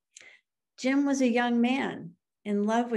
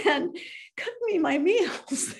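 said through laughter, much higher; laughing; 10 semitones higher, 3 dB louder than usual. Real, not acted. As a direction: giggle the words out fondly. Fond laugh retelling wife's care.

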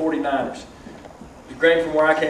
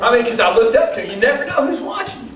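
ordinary talk, nothing marked

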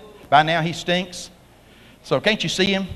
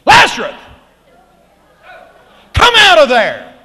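high, booming shouted summons; confident command; 8 semitones higher, 11 dB louder than usual. Retold or imitated. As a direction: bellow it with total authority. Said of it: "he says with all confidence".

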